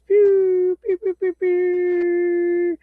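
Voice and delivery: high, slow pew pew; mock laser sounds. Host firing off the guests, high slow pews. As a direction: zap them off the show with a high pew. Launching the parachute program gag.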